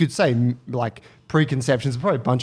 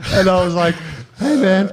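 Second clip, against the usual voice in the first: laughing; 11 semitones higher than usual. Real, not acted. Telling friends the story, laughing hard. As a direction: laugh through the retelling.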